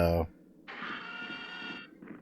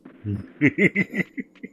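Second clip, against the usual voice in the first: high laugh; 8 semitones higher than usual. Real, not acted. Laugh after magical runes.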